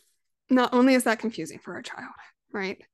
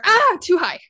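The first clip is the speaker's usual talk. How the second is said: higher, louder mock-frightened yelp; sudden scare; 9 semitones higher, 8 dB louder than usual. Mother acting out her son on the swing, suddenly scared. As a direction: imitate a child suddenly alarmed. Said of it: "all of a sudden we cross that line and he's scared".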